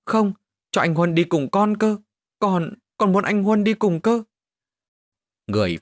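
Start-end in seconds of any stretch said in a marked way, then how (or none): 0.0-4.2 much higher, louder, tearful pleading; a little boy clinging to his mother, begging; whimpering child. Acted. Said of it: "mếu máo ôm chặt mẹ". (giọng trẻ con mếu máo, nài nỉ)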